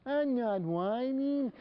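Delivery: very high, long-drawn whine; exaggerated kid whine, stretched out; whining child. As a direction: wail the denial like a whiny kid. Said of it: "kids who whine".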